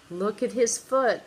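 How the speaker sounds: plain reading voice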